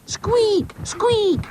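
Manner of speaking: very high squealed chant; yelling boy. Acted. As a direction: yelp each word in a child's voice. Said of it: "yelled Boz".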